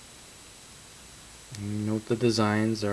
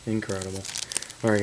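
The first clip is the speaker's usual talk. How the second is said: ordinary talk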